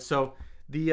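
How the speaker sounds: ordinary delivery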